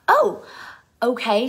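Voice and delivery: high, loud imitated surprise; startled reaction. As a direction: mimic a friend taken aback, loud and high. Acts out friend's startled, nervous reply.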